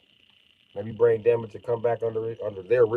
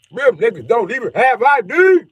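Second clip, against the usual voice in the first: loud punchline; 10 semitones higher, 7 dB louder than usual. Acted. Climax of list.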